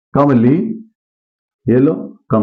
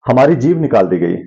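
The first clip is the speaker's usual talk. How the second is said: ordinary talk, nothing marked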